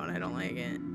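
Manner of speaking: low, soft, uneasy grumble; uneasy aside. Real, not acted. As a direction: shrink back, voice dropping. Uneasy at creepy doll, repeats it.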